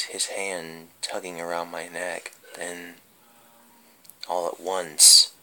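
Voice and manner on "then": usual speaking voice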